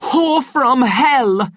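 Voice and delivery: high, loud, drawn-out curse; furious shout. A narrator's reading. Savage shaking Lenina by the shoulders, slow furious roar. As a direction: roar it slowly with full rage. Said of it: "he shouted".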